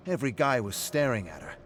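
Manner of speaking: trying too hard to convince; insistent bragging. Acted. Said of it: "You said that part a few times now".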